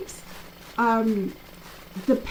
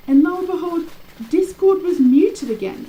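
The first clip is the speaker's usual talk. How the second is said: louder and higher, lo and behold; disbelieving, raised-voice reveal; exasperated reveal; 8 semitones higher, 8 dB louder than usual. Real, not acted. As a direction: build to the punchline of the glitch. Story climax of the glitch.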